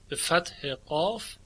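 ordinary delivery

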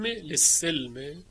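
للسلم slowly as "lis-silm", sin with i; kasra on sin. Shown as a demonstration. Teacher slowly demonstrates Shu'ba's kasra on sin. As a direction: stretch للسلم with an i after the doubled s. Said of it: "یعنی به کسر سین".

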